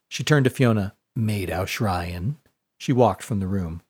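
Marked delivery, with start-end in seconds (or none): 1.2-2.4 lower, softer and very slow; husky and cool. A narrator's reading. Long-nosed girl dismissing Fiona, low and slow. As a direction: drawl the name out coldly. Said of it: "in a husky voice".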